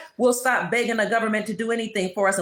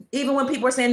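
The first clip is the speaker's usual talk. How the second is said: ordinary delivery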